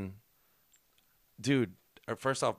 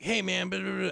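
booker greeting; 9 semitones higher than usual. Retold or imitated. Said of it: "He's like".

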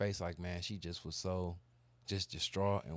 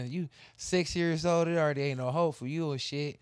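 higher, louder mock despair; incredulous; 7 semitones higher, 7 dB louder than usual. Real, not acted. Speaker to cohost about the six year old, louder disbelief. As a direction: laugh at the hopelessness.

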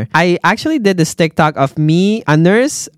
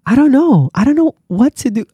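flustered outburst; 8 semitones higher than usual. Real, not acted. Nurse unsure what to do, flustered high outburst. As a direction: throw up your hands, voice jumping up. Overwhelmed by the patient's secret.

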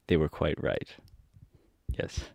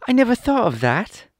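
put-on high, louder girlish delight; joyful Dorothy; 5 dB louder than usual. A narrator's reading. Dorothy hears the Scarecrow's monkey plan, high joyful voice. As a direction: exclaim happily as a little girl. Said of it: "said Dorothy joyfully".

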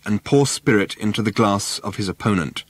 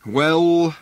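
sighed; 7 semitones higher than usual. A narrator's reading. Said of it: "sighed Mister Prosser".

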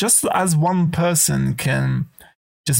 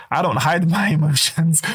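ordinary talk, nothing marked

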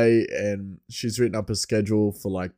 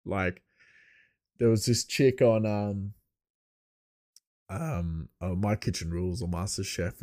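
usual speaking voice

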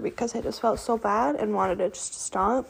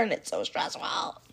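whisper; 8 semitones lower, 9 dB quieter than usual. Real, not acted. Unvoiced, low.

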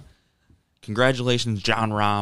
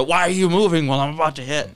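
put-on higher, louder Spanish-accented yell; Jon Rahm Spanish accent; 7 semitones higher, 7 dB louder than usual. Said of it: "in a Spanish accent".

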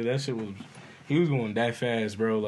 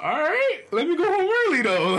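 much higher, laughing through the line; 13 semitones higher, 4 dB louder than usual. Retold or imitated. To the boss about leaving early, high and laughing. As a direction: giggle while begging to leave. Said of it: "I was like".

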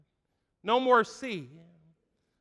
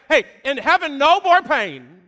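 preaching climax; 6 semitones higher, 12 dB louder than usual. Real, not acted. Preacher exhorting the church, shouting louder and higher. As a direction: shout the promise to the congregation.

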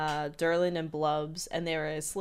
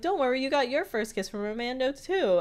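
teasing Mabel; 7 semitones higher than usual. Retold or imitated. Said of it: "Mabel's like".

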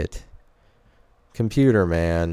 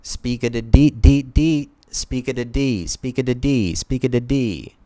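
scatting character; 4 semitones higher, 3 dB louder than usual. Acted. Speakeasy Tom scat continues.

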